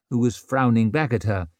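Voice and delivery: usual speaking voice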